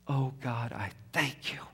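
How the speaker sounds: soft whisper; whispered thanks. Retold or imitated.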